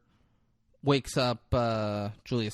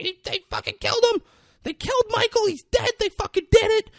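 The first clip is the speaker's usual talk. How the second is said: hammed-up, very high panicked shriek; panicked screaming; 6 dB louder, faster than usual. Girl runs up to people on porch, panicked screaming.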